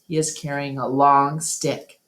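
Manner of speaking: ordinary delivery, nothing marked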